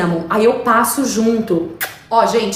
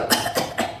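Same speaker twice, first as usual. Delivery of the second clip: quiet put-on cough; demonstrated cough; 8 dB quieter than usual. Shown as a demonstration. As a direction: fake little cough. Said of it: "Cough é isso aqui".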